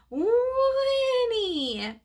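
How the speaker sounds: high squealing horse whinny; whinny like a real horse. A narrator's reading. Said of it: "Joey let out a loud horse whinny".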